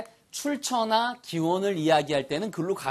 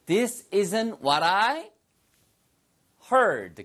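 very slow, clear model sentence; slow teacher demo; 5 semitones higher, much slower than usual. Shown as a demonstration. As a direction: stretch every word for learners. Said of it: "영어로 뭐라 할까요?".

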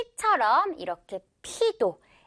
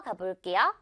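normal talking voice

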